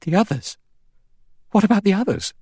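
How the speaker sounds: high, urgent question; alarmed. A narrator's reading. Vera to Miss Brent about the others, in alarm. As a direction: press the question, voice jumping up in alarm.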